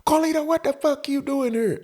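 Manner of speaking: very high mock female screech; high, put-on angry woman voice; yelling out the window. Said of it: "She yelling out the window".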